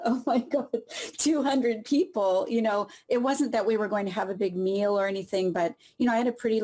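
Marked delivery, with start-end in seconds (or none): 0.0-2.4 higher, laughing disbelief; half-laughing shock at the crowd size; a stunned, laughing oh my God; surprised. Retold or imitated. Shock at news of big crowd.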